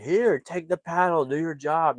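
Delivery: imitated impatient command; mock exasperated order. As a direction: urge a useless guide to get working. Retold exasperated order to the instructor.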